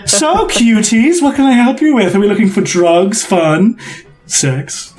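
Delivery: drawn-out, lilting sales pitch; playful, sultry shopkeeper; flirtatious emporium host. Acted. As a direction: start high and coy, sink down suggestively. Host Tullus offers his wares.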